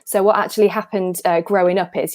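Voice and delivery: ordinary talk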